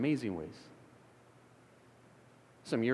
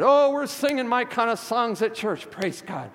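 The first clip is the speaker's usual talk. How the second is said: put-on excited old lady; 9 semitones higher, 7 dB louder than usual. Ninety-one-year-old church lady voiced.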